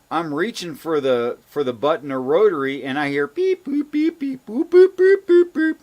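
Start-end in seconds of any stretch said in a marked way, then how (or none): 3.3-5.8 high monotone dialing beeps; sing-song beep-boop tones, pitched way up; imitated phone beeps. Caller retelling a phone beeping in their ear, high mimicked beeps. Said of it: "making these beeping noises".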